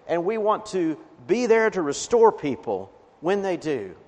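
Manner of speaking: ordinary delivery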